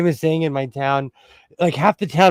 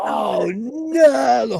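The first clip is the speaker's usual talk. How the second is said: death cry; 7 semitones higher, 3 dB louder than usual. Pretend. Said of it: "Let me let out my Klingon death scream".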